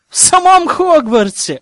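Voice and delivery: high, loud indignant exclaim; indignant outburst. A narrator's reading. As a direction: throw up your hands, outraged. Outraged narration about dungeons.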